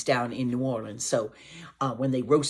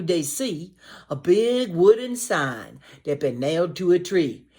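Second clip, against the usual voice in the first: lively, higher, louder read-aloud; storybook reading; 4 semitones higher, 4 dB louder than usual. Acted. Teacher reading a Cajun rhyme aloud to children. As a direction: read the rhyme with bouncing energy.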